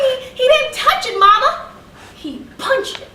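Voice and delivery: put-on high, pleading little girl explaining herself; child's protest. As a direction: protest to mama, high and urgent, then drop at the end. Child protests to scolding mama.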